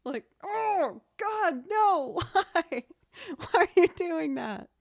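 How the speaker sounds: high, loud mock horror yelp; retold horrified yell. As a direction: shriek like the movie just grossed you out. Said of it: "were like".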